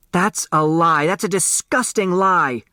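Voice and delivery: high indignant shout; mock outraged shout. A narrator's reading. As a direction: fling the denial back, rising. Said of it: "Turtle shouted".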